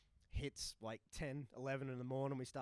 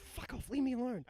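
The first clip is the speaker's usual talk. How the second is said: put-on grumpy drunk; 11 semitones higher, 7 dB louder than usual. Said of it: "He's like".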